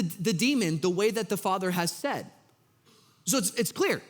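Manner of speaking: ordinary delivery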